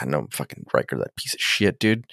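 low, very soft disgruntled mutter; imitated private griping, low and quiet; muttered grumble. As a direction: grumble it under your breath as a fed-up captain. Retold grumbling Picard aside.

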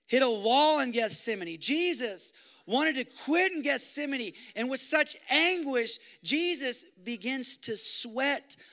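impassioned preacher voice; full-force preaching. Acted. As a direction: drive the story of Gethsemane home. Preaching builds toward climax.

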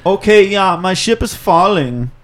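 terrible Tommy. Pretend. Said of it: "that was a terrible Tommy".

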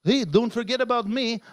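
normal talking voice

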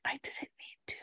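soft whispered confession; teacher quietly whispering the reveal. Retold or imitated. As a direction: breathe it like a quiet secret.

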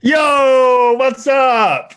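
high, loud hype-man yell; screaming hello. Real, not acted. Guest yells his greeting to the podcast hosts, loud and high. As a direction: burst in yelling to greet the crowd. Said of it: "when he starts screaming like that".

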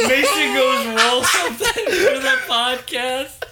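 high, teary laughing voice; crying laughing. Real, not acted. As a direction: squeeze words out through tears of laughter.

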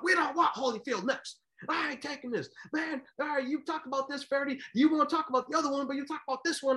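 high, fast ranting complaint; ranting Don King. Retold or imitated. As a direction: keep hammering the commentator. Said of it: "It was going on and on".

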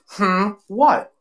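imitated puzzled hum and question; mock questioning. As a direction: act out a puzzled reply. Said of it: "Just like that".

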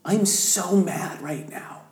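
hushed, tight whisper of anger; whispering fury to God. Retold or imitated. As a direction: whisper it through held-in rage. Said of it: "But I started getting so angry".

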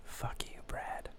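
whispered curse. Real, not acted. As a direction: hiss it under your breath. Whispered insult to Brad.

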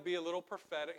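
ordinary talk, nothing marked

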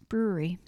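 brewery said with small rounded lips; coaching a friend, lips pursed tight on brewery; tiny lips. Shown as a demonstration. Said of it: "you gotta do tiny lips".

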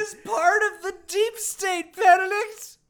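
high, loud, laughing disbelief; delighted shocked yelling; incredulous outburst. Real, not acted. Astonished outburst at a plot reveal.

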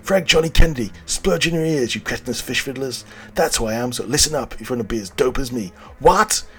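high, loud, shouty pitchman; brash huckster. Acted. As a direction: sell it like a sleazy infomercial. Brash twin pitches crypto.

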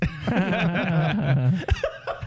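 high-pitched put-on giggle; stagey little giggle; fake giggle. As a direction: giggle like a musical heroine. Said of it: "a real giggle following the fake giggle".

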